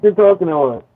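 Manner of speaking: muffled, muddy mic sound; like talking through a wall. Real, not acted. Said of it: "Yes, very much so".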